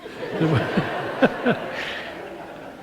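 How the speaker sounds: airy, amused laugh; real laugh. Real, not acted. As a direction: laugh at the quip you just quoted. Laughs after quoting wife's quip.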